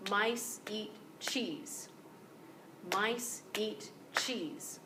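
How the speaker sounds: MICE and CHEESE held long, x0.5 slow; stress on nouns and verbs. Shown as a demonstration. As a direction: beat on "Mice" and "cheese", very slowly. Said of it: "we stress nouns and verbs".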